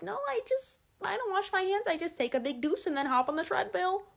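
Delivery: put-on high, airy valley girl; cute girl voice. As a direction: chirp it like a carefree girl. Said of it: "girls that are like".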